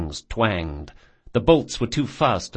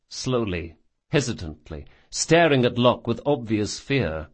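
usual speaking voice

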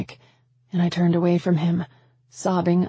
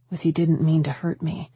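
usual speaking voice

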